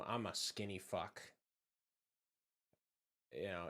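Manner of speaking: ordinary talk, nothing marked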